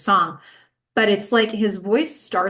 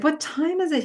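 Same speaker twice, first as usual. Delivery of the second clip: higher, drowsy just-woke voice; sleepy; 9 semitones higher than usual. Retold or imitated. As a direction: ask it half asleep. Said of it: "She moans, she yawns".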